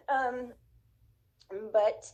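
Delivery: usual speaking voice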